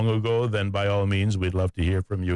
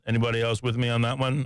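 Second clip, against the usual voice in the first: ordinary delivery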